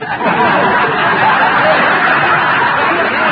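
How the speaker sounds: loud, breathy Shadow-style cackle; sinister laugh. Acted. Announcer teasing the hidden cast member with the Shadow's laugh. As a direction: break into the villain's cackle.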